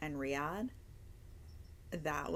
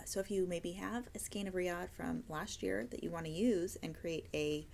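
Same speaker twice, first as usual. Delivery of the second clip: ordinary talk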